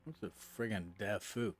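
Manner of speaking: usual speaking voice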